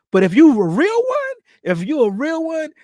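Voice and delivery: excited shout-out; hyped emphasis. Real, not acted. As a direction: pump up the line. Hyping up loyal viewers.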